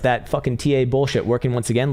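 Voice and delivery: normal talking voice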